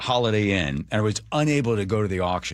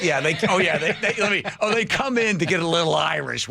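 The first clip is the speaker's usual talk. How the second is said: incredulous laugh; 9 semitones higher than usual. Retold or imitated. Incredulous retold reaction.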